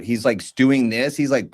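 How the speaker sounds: ordinary talk, nothing marked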